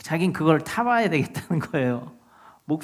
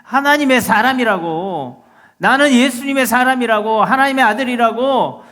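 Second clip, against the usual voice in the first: bold shouted proclamation; 10 semitones higher, 10 dB louder than usual. Shown as a demonstration. Preacher proclaims to the devil he belongs to God, bold shout. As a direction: cry it out boldly at the devil. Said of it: "담대히 외칠 수 있어야 된다".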